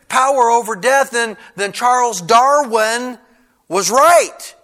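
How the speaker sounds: high, loud, driving sermon voice; full-force preaching. Real, not acted. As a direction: press the argument hard, phrase after phrase.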